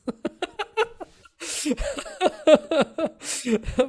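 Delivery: high, bright laugh at a comment; real burst of laughter. Real, not acted.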